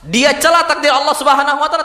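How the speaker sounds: normal talking voice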